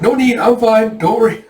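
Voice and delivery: hammed-up nervous protest; mock flustered refusal. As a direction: hastily wave the help away. [name] frantically refuses a doctor.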